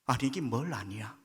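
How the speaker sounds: usual speaking voice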